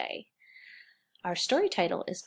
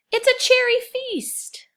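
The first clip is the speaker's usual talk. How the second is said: much higher, louder cry of delight; excited exclamation; 11 dB louder than usual. Acted. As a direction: burst out with joy at the feast. Storybook exclamation to children.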